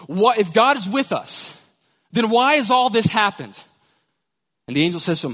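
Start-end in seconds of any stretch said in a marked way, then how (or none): 0.0-3.6 loud, angry demand; angry outburst. Acted. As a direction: explode at the angel. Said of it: "he explodes on the angel".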